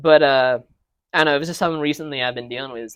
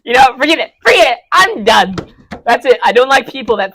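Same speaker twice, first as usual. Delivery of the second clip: louder, higher mock exasperation; mock outburst; 9 semitones higher, 13 dB louder than usual. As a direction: throw up your hands in fake outrage. Mock offended, giving up.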